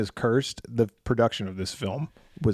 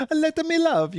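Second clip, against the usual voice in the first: high mock-Italian cartoon plumber; Mario impression. Riffing on a song as Mario, high cartoon Italian voice. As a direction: play Mario pleading, pitched way up.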